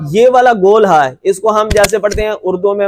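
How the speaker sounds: ordinary talk, nothing marked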